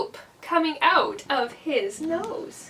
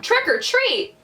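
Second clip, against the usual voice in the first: high, clearer call; a little louder; 9 semitones higher than usual. A narrator's reading. Lance tries the lady once more, a little louder. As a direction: lift the voice a notch, pitched up. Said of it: "He said a little louder".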